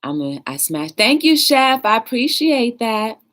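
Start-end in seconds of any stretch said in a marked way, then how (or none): 1.0-3.2 higher, lifted grateful call-out; bright thanks. Real, not acted. Streamer thanking Chef in the chat, high grateful call-out. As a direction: sing out your appreciation to a viewer.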